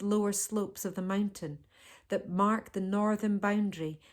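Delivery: usual speaking voice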